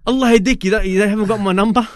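the sheikh scolding over the phone, high and loud; mock scolding. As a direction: tease him loudly like an old teacher. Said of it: "And then he goes".

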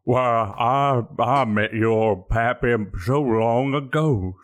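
hammed-up old codger; sudden old-man voice. Customer recalling the boatman's pappy, put-on old voice. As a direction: age yourself mid-conversation, drawing out words. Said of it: "did you get old all of a sudden?".

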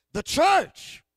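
loud, high, believing shout; with conviction. Shown as a demonstration. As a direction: belt it like you mean it. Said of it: "say like you believe in the church".

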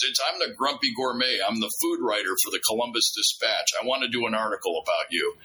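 ordinary talk, nothing marked

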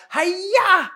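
high, loud karate yell. Retold or imitated. Daughter slaps dad's belly, imitated high loud yell. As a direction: belt it out like a kid's karate chop. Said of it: "She yelled".

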